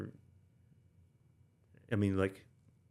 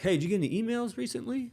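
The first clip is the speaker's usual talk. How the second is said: teasing question; 14 semitones higher, 5 dB louder, faster than usual. Retold or imitated. Sergeant major asks teasingly.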